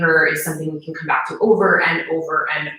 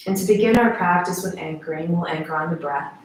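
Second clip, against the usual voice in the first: guided meditation; 2 semitones lower than usual. Acted. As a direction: guide them gently into stillness, unhurried.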